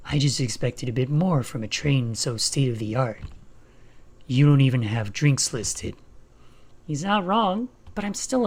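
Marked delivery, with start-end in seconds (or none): 0.1-6.0 low Shadow voice. Acted.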